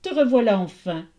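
loud, heartfelt storybook reunion; crying out. A narrator's reading. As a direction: welcome her back with feeling. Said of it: "s'écria Déméter".

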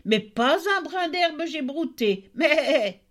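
high, sing-song goat voice, rising on each line; a sly goat chanting its complaint; mocking goat. Goat's lying rhyme. (chèvre moqueuse à la voix aiguë)

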